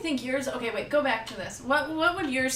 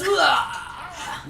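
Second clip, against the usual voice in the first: loud orc roar with a laugh; 5 semitones higher, 5 dB louder than usual. Pretend. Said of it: "My best orc voice".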